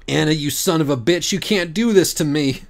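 high, loud tearful scolding; tearful anger. A narrator's reading. Pam sobbing at her friend, high and loud. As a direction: cry at her through tears.

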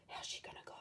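hushed worried whisper; whispered suspense question. Real, not acted. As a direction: whisper it like a secret. Aside whispered to listeners.

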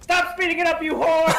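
high, loud outburst; exasperated yell. Acted. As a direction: yell it in mock outrage at the player. Sudden outburst at another player.

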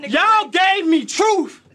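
Y'all gave me truth, drawn out with weight; punchline repeated slowly for effect; slow emphatic repeat. Acted. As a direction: lean into the line slowly. Bar repeated after pause.

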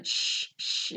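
imitated unvoiced "shh, shh"; shushing. Husband hushes his questioning wife, shushing. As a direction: hush the questions away.

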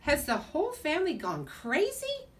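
baffled little creature; lizard exclaiming. Acted. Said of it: "said Oscar aloud".

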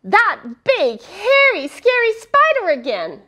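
high frightened cry; scared shout. Acted.

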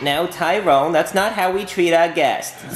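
ordinary delivery

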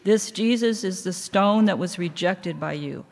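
ordinary delivery

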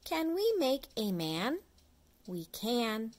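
ordinary delivery, nothing marked

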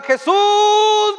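high, loud cry of the lepers; loud distant call of Jesus' name. Retold or imitated. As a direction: shout his name from far away. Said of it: "le gritaban".

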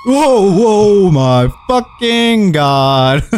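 loud, high startled whoa, then slow; real fright bursting out; terrified. Real, not acted. Streamer jolted by a horror game scare, loud real fright.

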